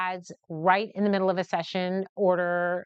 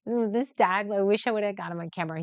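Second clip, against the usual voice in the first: ordinary talk, nothing marked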